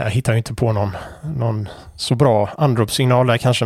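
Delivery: usual speaking voice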